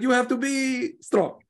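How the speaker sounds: Italian manager holding back a swear word; Italian accent. Retold or imitated. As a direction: drag it out like an Italian coach. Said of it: "He's like".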